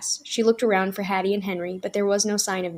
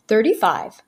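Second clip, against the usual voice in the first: called out; 6 dB louder than usual. A narrator's reading. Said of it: "called another".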